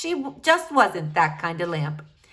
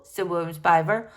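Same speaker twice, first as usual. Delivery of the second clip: ordinary delivery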